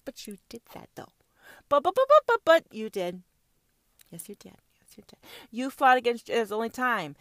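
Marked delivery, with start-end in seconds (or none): 0.0-5.2 hushed teasing whisper. Acted. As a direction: whisper it back slyly. Whispered retort then fading.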